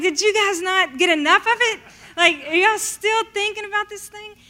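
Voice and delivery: can't believe they're still asking; exasperated disbelief. Retold or imitated.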